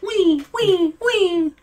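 very high, thin little "wee"s; playful squeal. Pretend. Player mimicking his bouncing microphone bar, playful high squeal.

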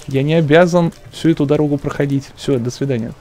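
ordinary talk, nothing marked

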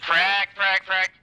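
urgent shouted warning; grenade warning yell. Real, not acted. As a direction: bark the warning to the squad. Calling out grenade to squad.

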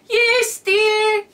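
put-on high, loud wobbly old man; comic old man voice. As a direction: play a frail grandpa. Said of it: "turning to an old man".

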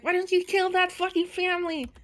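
hammed-up indignant ghost; mock angry demand. Player retells the ghost's demand, mock furious. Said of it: "she was really like".

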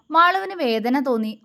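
ordinary delivery, nothing marked

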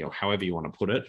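ordinary talk

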